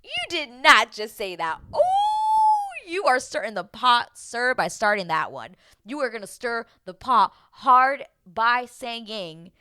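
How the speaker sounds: loud, emphatic mock outrage; scandalized scolding. Viewer scolding someone for stirring the pot, scandalized. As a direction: stretch out the key words, loudly scandalized. Acted shocked reaction to sketchy remark.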